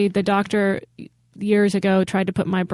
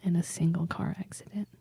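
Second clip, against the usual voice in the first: whispered, much softer, like a conspiracy; spooky whisper; 11 dB quieter than usual. Pretend. Host repeats the fact to cohost in an eerie whisper. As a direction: hush it as if it's a secret.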